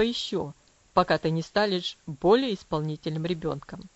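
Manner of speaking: low, severe father's voice; stern father. Acted. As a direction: forbid it firmly from above. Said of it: "сурово посмотрел на неё и сказал". (сурово, низким голосом отца)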